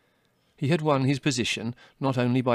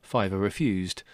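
ordinary delivery, nothing marked

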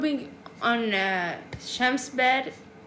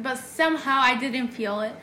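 ordinary talk

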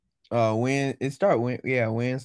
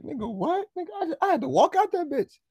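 imitating roommate. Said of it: "He'd be like".

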